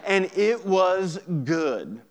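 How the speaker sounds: hammed-up, drawn-out catchy refrain; playful radio-hit delivery. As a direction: belt out "And it was good" like a radio hook. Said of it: "That might be a radio hit".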